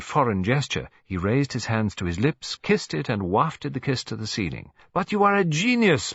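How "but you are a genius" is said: very high, louder French gush; Poirot prijst de kokkin uitbundig; effusive praise. A narrator's reading.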